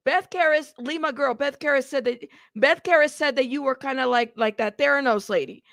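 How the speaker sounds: ordinary talk, nothing marked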